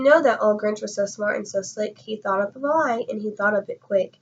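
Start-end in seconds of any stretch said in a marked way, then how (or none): none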